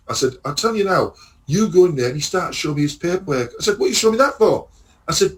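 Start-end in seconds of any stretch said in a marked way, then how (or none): 0.4-2.1 raised-pitch telling-off; indignant retold warning. Warning the driver in retold quarrel. 3.8-4.7 high-pitched indignant question; exasperated retold comeback; retold indignation.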